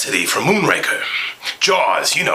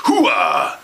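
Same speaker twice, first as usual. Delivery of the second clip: hooah yell; 7 semitones lower than usual. Acted. Catchphrase yell.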